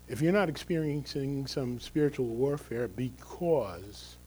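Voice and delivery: ordinary delivery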